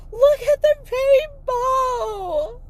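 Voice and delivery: high, loud tearful cry. Retold or imitated. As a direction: shout it through tears at strangers. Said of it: "ugly running".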